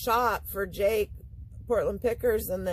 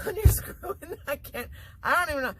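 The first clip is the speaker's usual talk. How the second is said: cracking up at own slip; giggling through the words; laughing; 8 semitones higher than usual. Real, not acted.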